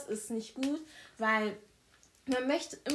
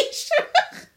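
höher und lauter, mit Lachen durchsetzt; lachend; 12 dB louder than usual. Real, not acted. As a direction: lacht es heraus, fast kreischend. Lacht über eigene laute Stimme. (lachend zur Freundin, über ihre laute Stimme)

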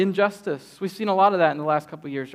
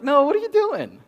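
higher, louder alarmed scold; shocked scolding; 8 semitones higher, 5 dB louder than usual. Retold or imitated. Mother stopping her son from lashing out, shocked scold. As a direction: cut him off in disbelief. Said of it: "and I was like".

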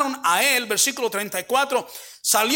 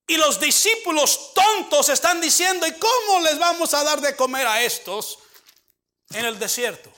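higher, raised preaching voice; emphatic sermon delivery; 5 semitones higher than usual. Acted. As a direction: build the point to the congregation.

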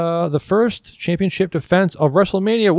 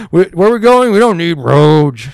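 loud, high mad-scientist impression; hammed-up Doc Brown line; Doc Brown quote; 3 semitones higher, 9 dB louder than usual. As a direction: boom it out like the crazed inventor. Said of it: "Doc, this is".

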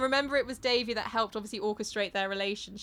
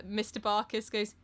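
ordinary delivery

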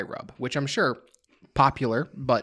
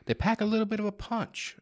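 higher, teasing understatement; playful understatement; 9 semitones higher, 4 dB quieter than usual. Acted. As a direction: say it like it's no big deal. Understating the pepper's heat.

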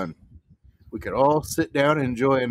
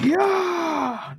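excited cheer. Real, not acted. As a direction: whoop with joy. Player cheering a good roll.

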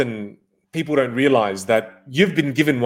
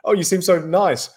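higher-pitched admiring voice of a flatterer; pitched-up, admiring mock compliments; imitated gushing compliment; 6 semitones higher than usual. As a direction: imitate someone gushing over a new acquaintance. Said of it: "compliments your personality and they go".

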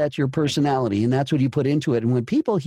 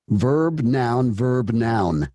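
ordinary delivery

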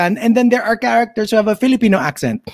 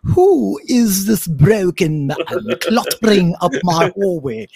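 mock British devil; slower than usual. Imitating the British-accented devil in biblical movies, slow and sneering. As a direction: drawl it out like a posh British devil. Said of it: "the devil will always be British".

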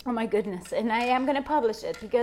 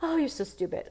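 self-critical mutter; 8 dB quieter than usual. Retold or imitated. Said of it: "and I was like".

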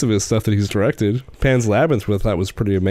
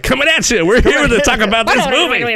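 high, loud radio hype voice; hyped radio DJ; 11 semitones higher, 3 dB louder than usual. Retold or imitated. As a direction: pump it up like a morning DJ. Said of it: "we don't just come in there like".

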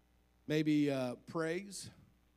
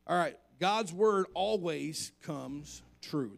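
1 preaching emphasis; 6 dB louder, slower than usual. Acted.

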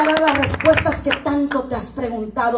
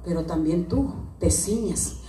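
low, much softer prophetic speech; hushed prophetic voice; 11 semitones lower, 10 dB quieter than usual. Acted. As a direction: speak quietly as if under the anointing. Quiet anointed prophetic passage.

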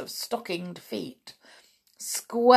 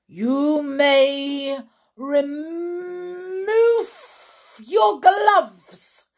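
high, loud, harsh witch's order; a witch queen barking at her subjects; shouted command; 8 semitones higher, 5 dB louder, much slower than usual. A narrator's reading. Grand High Witch ordering the witches, high harsh shout. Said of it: "she shouted".

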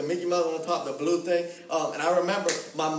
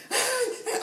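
high, squealing mock scream; imitated mom screaming. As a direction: squeal like an overexcited mom, without much volume. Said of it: "my mom starts screaming".

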